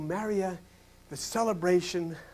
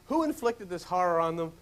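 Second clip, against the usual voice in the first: ordinary talk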